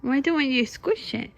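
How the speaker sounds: ordinary delivery, nothing marked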